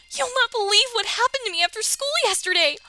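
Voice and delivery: very high, loud squealing; high excited Miriam voice. Acted. Streamer voicing Miriam bragging about a date. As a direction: announce the date like big news.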